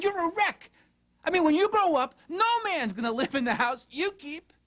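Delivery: high-pitched, exasperated mock rant; hammed-up parental tirade, voice climbing; scolding parent. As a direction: act out a parent berating a messy teen. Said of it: "you hear yourself saying".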